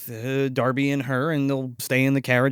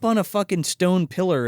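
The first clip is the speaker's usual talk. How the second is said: ordinary delivery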